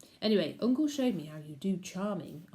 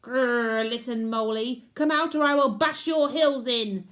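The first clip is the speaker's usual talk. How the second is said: loud growling threat, rising louder; shouted down the molehill; 5 semitones higher, 9 dB louder than usual. A narrator's reading. Uncle shouting threats down a molehill, loud. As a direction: bellow into the hole. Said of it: "he shouted down the molehill".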